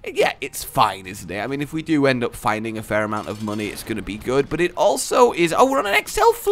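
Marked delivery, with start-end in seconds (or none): 5.6-6.5 much higher and fast, a sing-song squeal; sudden cartoonish squeal; silly high voice. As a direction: squeak out the words in a goofy high voice. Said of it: "I don't know why I needed to say it like that".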